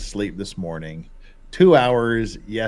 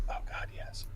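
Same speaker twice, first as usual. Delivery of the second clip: whisper; 16 dB quieter than usual. Retold or imitated. Retelling panicked reply to secretary.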